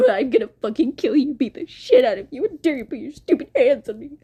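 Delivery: rushed, high-pitched raging rant; put-on angry villain voice; mock furious threat. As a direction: play an attacker ranting, hammed-up. Acts out attacker's threat.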